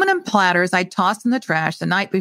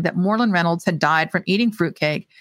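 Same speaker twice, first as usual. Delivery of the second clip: ordinary delivery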